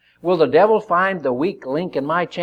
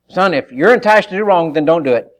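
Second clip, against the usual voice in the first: fatherly warning; 4 semitones higher, 7 dB louder than usual. Acted. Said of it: "He could say".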